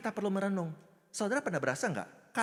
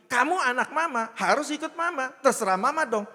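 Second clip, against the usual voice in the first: bossy mother laying down the law, high and loud; mock-scold a child with parental authority; ibu galak; 7 semitones higher, 7 dB louder than usual. (omelan ibu yang sok berkuasa)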